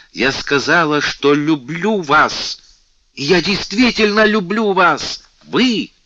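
high, loud and slow, swelling with feeling; passionate declaration. A narrator's reading. Louise on her knees confessing love to the king, fervent. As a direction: pour out love at full pitch. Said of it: "увлечённая неистово пылким чувством".

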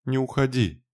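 a high, anguished cry of "don't go"; almost a scream. A narrator's reading. Lorna begs the dying Raine not to leave, crying out. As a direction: cry out, nearly screaming, begging him to stay. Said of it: "чуть не закричала в голос".

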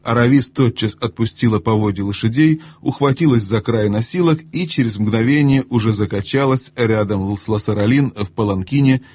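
plain reading voice

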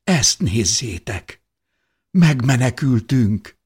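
rising wonder bursting into joy; amazed exclamation. A narrator's reading. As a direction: let awe grow into loud relief. Repeated cry of relief at discovery.

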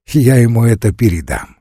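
ordinary delivery, nothing marked

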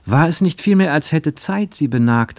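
ordinary delivery

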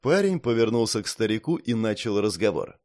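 ordinary delivery, nothing marked

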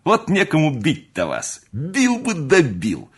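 high, loud scolding with stretched words; loud, exasperated chiding; mock scolding. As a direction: scold with relish, drawing out the last words. Mock scolding of tearful mother.

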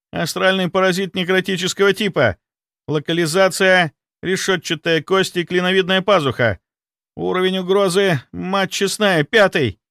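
high-pitched, agitated announcement; alarmed report. A narrator's reading. Young inquisitor reporting the threat level to captain, alarmed.

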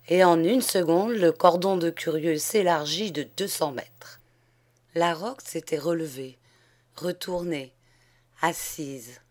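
ordinary delivery, nothing marked

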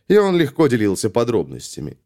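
usual speaking voice

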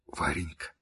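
soft, hushed plea for forgiveness; whispering. Acted. Girl begging her friend's forgiveness, whispered. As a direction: whisper an apology, ashamed. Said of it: "прошептала Кити".

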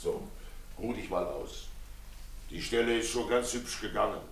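soft, soothing words to calm her; husband quietly calming his upset wife. Acted. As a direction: hush her gently like an upset child.

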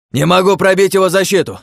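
high loud frustrated shout. A narrator's reading. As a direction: yell it while hacking away. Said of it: "крикнул мечик".

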